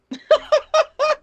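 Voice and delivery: loud, very high laugh; a real high squeal of laughter; shrieking laugh. Real, not acted. Friend cracks up at the Barbie excuse with a shrieking laugh.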